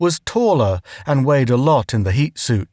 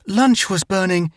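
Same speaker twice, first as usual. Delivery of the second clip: panting; 7 semitones higher than usual. A narrator's reading. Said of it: "she panted".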